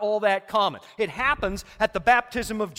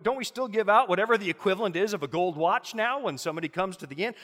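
usual speaking voice

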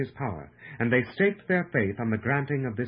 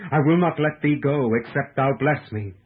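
loud, determined cry, raised well above narration; pleading cry; 3 semitones higher, 7 dB louder than usual. A narrator's reading. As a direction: cry it out as if refusing to let go. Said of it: "wrestling with the angel, he cried".

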